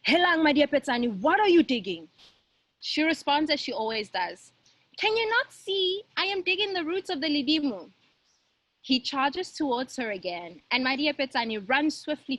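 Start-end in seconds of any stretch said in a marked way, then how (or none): 0.1-2.1 louder calling shout. Acted. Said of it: "So he shouts". 5.0-7.9 put-on cheeky girl. Girl's cheeky reply.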